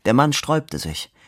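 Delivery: ordinary delivery, nothing marked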